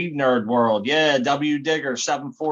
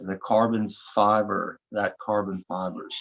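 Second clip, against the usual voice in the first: ordinary talk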